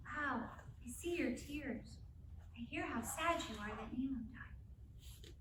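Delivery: soft, slow and tender; gentle validating. Shown as a demonstration. Mother comforting her sad daughter, soft and slow. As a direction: soothe a crying child gently. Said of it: "And I say".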